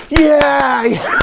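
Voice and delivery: high imitated cheering shout; crowd cheer. Street people cheer the rescue, high crowd cheer. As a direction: whoop like an onlooking crowd. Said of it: "all these street people are going".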